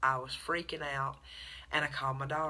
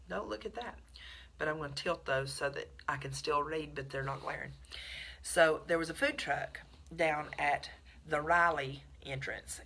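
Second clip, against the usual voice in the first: ordinary talk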